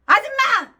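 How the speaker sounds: high, loud angry call; indignant yell of "아줌마"; retold call-out. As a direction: shout for the shop owner. Retold calling out to laundress.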